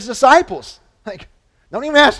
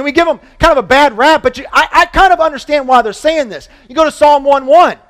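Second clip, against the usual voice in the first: normal talking voice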